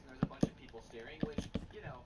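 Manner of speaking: normal talking voice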